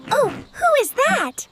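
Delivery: high curious gasp; sudden wonder; surprised. Acted. Spots a crying crocodile.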